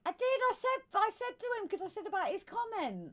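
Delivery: high-pitched insistent protest; indignant protest. Real, not acted. Host defending herself to co-host over a comment, protesting. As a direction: protest your innocence, pitched right up.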